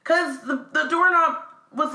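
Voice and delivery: ordinary talk, nothing marked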